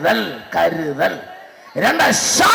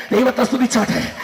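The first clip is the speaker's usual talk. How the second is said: normal talking voice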